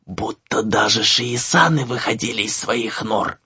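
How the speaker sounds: slow, hushed whisper; secretive gossip whisper; barely audible whisper. A narrator's reading. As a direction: whisper a scary secret so others won't hear. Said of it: "зашептала едва слышно".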